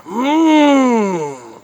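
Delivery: milk the bite for the camera; high loud mock moan. Streamer savoring a slice on camera, high mock moan. Exaggerated eating moans.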